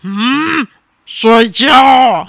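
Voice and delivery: loud exasperated bedtime shout; mock losing it. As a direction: erupt like a mother at the end of her patience. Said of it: "抓狂嘛".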